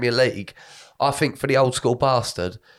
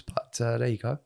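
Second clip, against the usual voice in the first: ordinary talk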